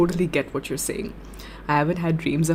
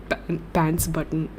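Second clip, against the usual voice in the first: ordinary delivery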